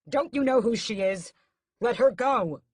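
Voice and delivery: desperate furious shout at the guards; angry demand. A narrator's reading. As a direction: force them to let her go. Raoul fighting toward her.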